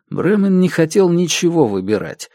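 usual speaking voice

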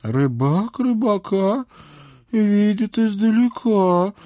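slow, high cryptic maiden; vague mysterious reply. A narrator's reading. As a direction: answer evasively, like a riddle. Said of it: "туманно ответила толстушка".

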